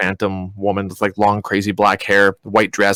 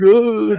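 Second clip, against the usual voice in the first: high shudder of leftover fright; acted shuddering groan; 11 semitones higher, 4 dB louder than usual. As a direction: groan as if still shaking from a nightmare. Acting shuddering while cuddled.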